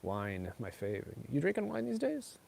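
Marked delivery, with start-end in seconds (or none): 1.3-2.4 high put-on voice. Playful reply to a chat comment.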